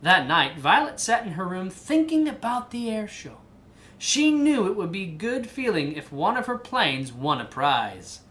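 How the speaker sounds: lively picture-book narration; reading to a child. A narrator's reading. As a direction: read the story aloud to your kid.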